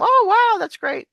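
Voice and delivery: high, loud mock amazement; demonstrated excitement. Shown as a demonstration. Teacher showing when Americans go up, excited squeal of approval. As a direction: soar up on "wow" as an example of excitement. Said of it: "or excitement".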